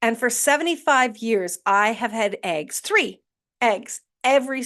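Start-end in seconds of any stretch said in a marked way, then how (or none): none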